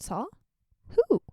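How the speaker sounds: high, loud storybook gasp; loud, high read-aloud surprise for a children's book. Acted. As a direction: read it like a surprised character to kids. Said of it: "as though it's like a children's book reading".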